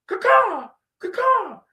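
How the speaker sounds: high, loud imitated hawk caw. Hyping Battlehawks fans.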